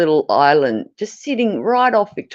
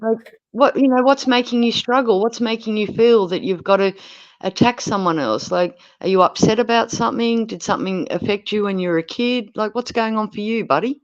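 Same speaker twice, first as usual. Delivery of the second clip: concerned, caring reply; 7 semitones higher than usual. Acted. Showing how to answer nasty trolls, high caring voice. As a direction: ask with exaggerated tender concern, as if to someone hurting. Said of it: "when people are nasty to me, I say".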